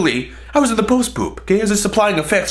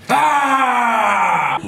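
long, high held "Ah" scream; soul-ripping scream; 4 semitones higher than usual. Retold or imitated. Man startling stall neighbour a second time, soul-ripping scream. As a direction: rip out a sustained scream. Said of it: "let out another soul-ripping scream".

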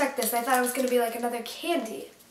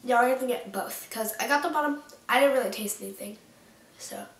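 talking while eating snack; nibbling between words; mouth full, chewing; faster than usual. Real, not acted. Seen 1: Hand at mouth, biting and chewing snack throughout the words.

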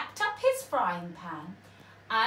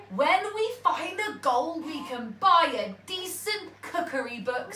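pirate captain; 6 semitones higher, 6 dB louder than usual. A narrator's reading. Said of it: "said Handkerchief".

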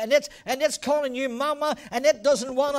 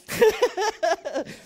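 laugh; 7 semitones higher than usual. Real, not acted. Pastor laughing at the stranger joke, real laughter. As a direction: burst of high laughter.

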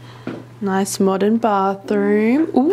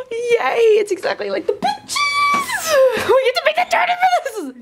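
excited screaming; 4 dB louder than usual. Real, not acted. Seeing the room for the [name] fans.

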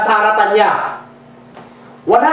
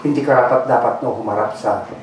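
low, soft and hushed prayer voice; 8 semitones lower, 5 dB quieter than usual. Acted. Pastor praying over the congregation, hushed and low. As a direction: pray softly over the church. Pastor prays before the sermon.

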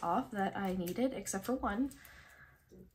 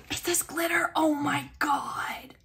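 high, loud burst, last words stretched out; excited exclamation; 4 semitones higher, 7 dB louder, slower than usual. Real, not acted. Collector discovers glitter, excited exclamation. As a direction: exclaim loudly, thrilled and amazed.

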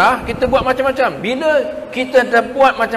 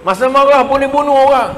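louder, higher forceful warning; emphatic warning; 7 semitones higher, 6 dB louder than usual. Real, not acted. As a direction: hammer the danger home. Warning about anger.

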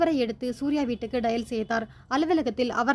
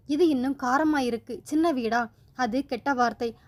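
ordinary delivery

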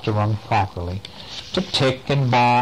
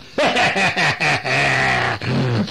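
sinister laugh. Acted. The freed bottle creature laughing menacingly. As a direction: cackle like the freed genie. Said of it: "not the laughter of a child".

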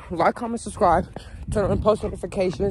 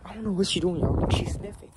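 usual speaking voice

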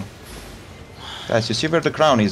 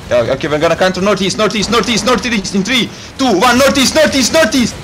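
shouted callout; 11 semitones higher, 6 dB louder than usual. Real, not acted. Shotcaller calling a counter.

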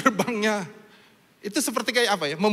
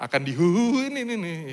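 ordinary delivery

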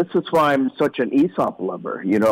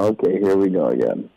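a lower, resigned here-we-go-again; mock weary sigh; 6 semitones lower than usual. Son to his dad, a lightly resigned groan. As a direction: play it as fed-up patience. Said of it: "I go".

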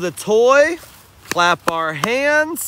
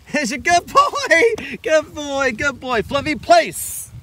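praising dog; 6 semitones higher than usual. Real, not acted. Praising dog after retrieve.

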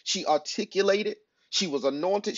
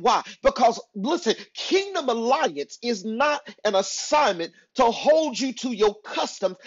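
raised, forceful sermon delivery; emphatic preaching; 3 semitones higher than usual. Real, not acted. Preacher urging listeners beyond their hometowns, emphatic preaching. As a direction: drive each point home to the congregation.

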